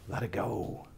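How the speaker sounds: soft breathy sigh, barely voiced; sighed letting go. A coach easing listeners into release, soft sighed words. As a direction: breathe out let it go. Sighing release after film story.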